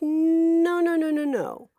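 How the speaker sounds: high, loud, insistent no; emphatic no. Retold or imitated. Correcting Beardo, high and loud insistent denial. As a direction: cut off the wrong idea firmly. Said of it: "It's like".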